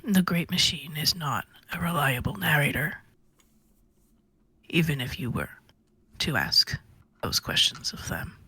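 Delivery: low, whispery character voice; hushed, low mumbling voice; Chifley's voice. Acted. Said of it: "this is just how he talks".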